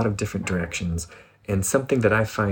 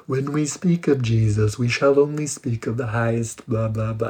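lightly pompous solemn tone; mock the over-reverent religious talk; lofty preacher. Mocking how people speak loftily of Jesus, lightly pompous. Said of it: "high levels of loftiness".

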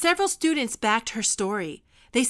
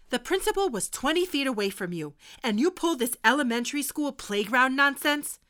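usual speaking voice

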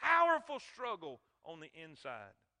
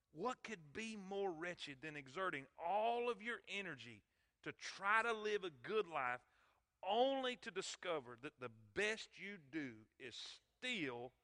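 usual speaking voice

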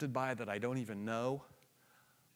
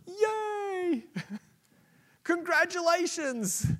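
very high, squealed celebration; hammed-up congratulations; mock cheer; 6 dB louder, much slower than usual. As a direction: cheer sarcastically like a game show host. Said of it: "and you go".

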